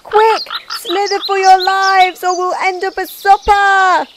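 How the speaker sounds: high, loud, urgent squeal; panicked cry. A narrator's reading. Snails fleeing the hungry bird, panicked. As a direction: urge everyone to flee for their lives. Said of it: "cried the snails in panic".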